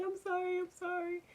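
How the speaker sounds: high, pleading imitation of a sob; a hint of tearful begging; mock crying plea. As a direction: beg like a kid being whooped, lightly. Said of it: "So I cried and stuff".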